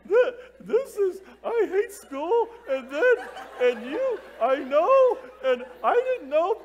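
hammed-up high, loud blubbering; openly weeping. Youth leader re-enacting being moved to tears, weeping openly. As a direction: imitate yourself bawling, overcome and barely getting sentences out. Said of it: "I'm, like, openly weeping".

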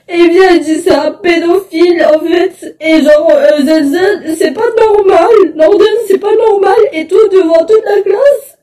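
tearful, voice wavering between sobs; crying. Real, not acted. Girl telling viewers about racism at school, crying. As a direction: speak through real tears.

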